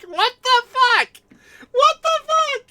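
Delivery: high loud shocked cat; startled cat voice. Retold or imitated. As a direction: voice the cat's alarmed reaction. Cat's reaction voiced.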